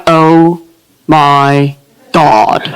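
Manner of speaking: low, deadpan manly oh my God; low mock man's panic; low manly freakout. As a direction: drop it deep and gruff. Her own freakout, manly.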